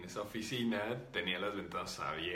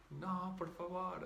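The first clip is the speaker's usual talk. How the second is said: high, drawn-out child's plea, imitated; frightened little girl; 10 semitones higher, 3 dB quieter, slower than usual. Spirit refuses to say goodbye, imitated frightened little-girl plea. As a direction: stretch it out like a scared little girl begging. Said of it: "O sea, tenía mucho miedo".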